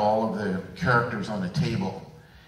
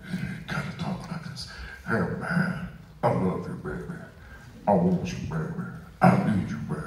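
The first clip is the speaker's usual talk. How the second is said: much lower, softer crooning of a soul singer; big deep singer voice; 5 semitones lower, 3 dB quieter than usual. Retold or imitated. Imitating a deep-voiced singer crooning to a lover. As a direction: croon it low like an old American singer. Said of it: "this old singer out of the U S A".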